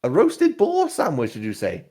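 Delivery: high, chiding barmaid voice; tavern owner voice. Acted. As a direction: scold him like a prim landlady. Lara voice.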